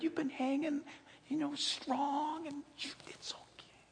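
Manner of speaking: hushed unvoiced coaxing whisper; tempter's whisper. Preacher voicing Satan coaxing the sinner, soft whisper. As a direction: soothe them softly, like a seducer. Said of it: "He'll say things like this".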